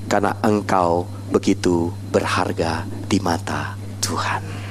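slow, low near-whisper; solemn whispered assurance; hushed reverence. Real, not acted. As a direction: let each word sink in, very softly. Preacher hushes for the key message.

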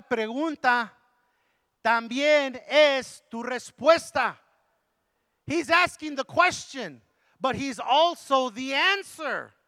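bilingual preaching, rising to a fired-up push. Acted. Pastor preaching healing to the congregation, full force.

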